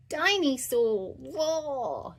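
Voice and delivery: put-on a little boy saying his favourite word; toddler voice. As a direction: George showing the teacher his dinosaur book, babyish.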